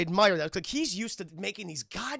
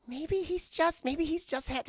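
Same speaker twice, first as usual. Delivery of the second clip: high, imitated self-reassuring wife; hopeful wife voice; 8 semitones higher, slower than usual. Anna talks herself round at the door, mock hopeful. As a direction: reason hopefully in a pitched-up voice.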